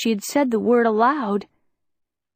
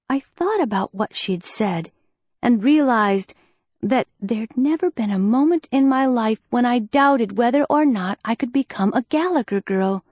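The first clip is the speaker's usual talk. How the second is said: ordinary delivery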